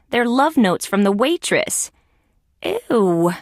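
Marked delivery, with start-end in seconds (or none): 2.6-3.3 drawn-out disgusted ew; long falling groan of disgust. Acted. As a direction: recoil from something gross. Reacting to love notes in disgust.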